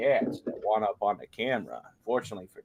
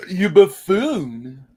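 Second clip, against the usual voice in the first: mock insult; 6 semitones higher, 6 dB louder, much slower than usual.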